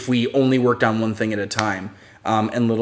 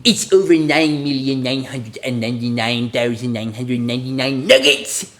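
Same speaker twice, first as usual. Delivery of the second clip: put-on cartoon voice at ordinary volume; character voice held back from a scream, rising on the last word; unscreamed cartoon catchphrase; Nugget. Shown as a demonstration. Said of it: "say in Nugget's voice".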